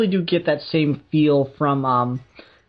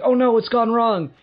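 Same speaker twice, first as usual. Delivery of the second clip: mock alarm; 9 semitones higher, 4 dB louder than usual. Hero's alarm quoted.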